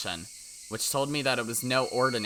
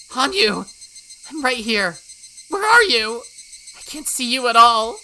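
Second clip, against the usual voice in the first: loud, high desperate calls into the dark; frantic calling; 12 semitones higher, 12 dB louder than usual. Acted. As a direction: yell her name to find her.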